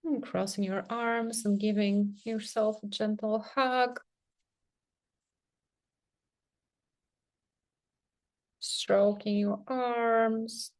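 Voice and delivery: soft, unhurried meditation voice with long pauses; guided meditation. Real, not acted. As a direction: gently guide them into sensing the body, leaving space between phrases. Leads a guided self-touch meditation.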